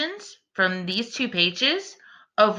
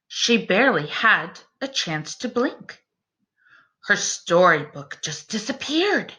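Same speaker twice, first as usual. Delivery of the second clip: story narration; 3 semitones lower than usual. A narrator's reading. Reading a rhyming bedtime book to the class, gentle storyteller voice. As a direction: read it like a picture book to little ones.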